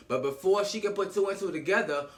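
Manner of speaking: normal talking voice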